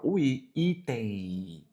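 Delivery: item said slowly and softly, showing the new I vowel; slow pronunciation demo. Shown as a demonstration. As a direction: draw out item gently so the I is heard. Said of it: "traz a ideia de uma nova vogal".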